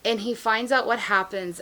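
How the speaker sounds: normal talking voice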